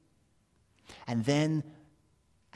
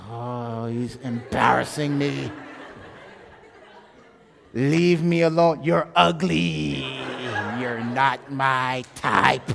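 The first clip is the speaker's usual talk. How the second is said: loud, drawn-out embarrassed complaint; mock exasperated rejection; 8 dB louder, slower than usual. Woman at restaurant rebuffing her suitor, exasperated. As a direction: brush off a pest, loud and dragged out.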